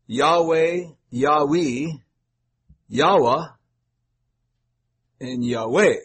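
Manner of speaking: "Yahweh" said several ways, each with a different ending; variant pronunciations. Shown as a demonstration. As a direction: list each variant of "Yahweh" distinctly, ending -way, -wee, -wah. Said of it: "And there is a difference".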